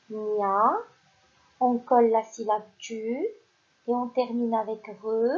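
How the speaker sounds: ordinary talk, nothing marked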